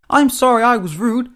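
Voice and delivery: high-pitched, eager apology; flustered high-voiced climbdown; hasty spluttering. A narrator's reading. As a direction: backtrack anxiously in a high voice. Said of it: "spluttered Henry hastily".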